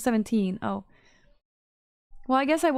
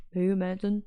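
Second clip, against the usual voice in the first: put-on Danish accent; 3 semitones lower than usual. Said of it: "Nailing the Danish accent".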